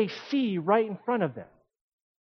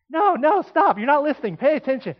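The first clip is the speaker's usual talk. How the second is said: loud, higher frustrated teacher snapping at students; 6 semitones higher, 6 dB louder than usual. Retold or imitated. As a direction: cut them off, exasperated and loud. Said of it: "I'm gonna get frustrated and say".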